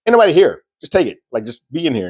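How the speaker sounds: high, pushed, impatient insistence; a mocking high voice waving off his worry; exasperated Carol. Carol tells Manusos nobody lives here, high and exasperated. Carol urges him to take food.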